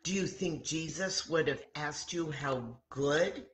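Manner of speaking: plain reading voice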